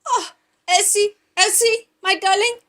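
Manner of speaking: high, loud put-on Mordecai voice. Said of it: "I've changed accent now".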